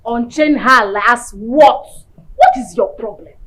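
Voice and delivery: loud, heated scolding refusal; angry refusal. Acted. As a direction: shut her down angrily. Refusing to unchain friend.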